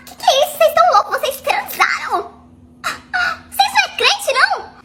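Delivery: high, outraged questions flung at the couple; scandalised girlfriend confronting her cheating fiancé; indignant outburst. Retold or imitated. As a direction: let the shock burst out high and fast.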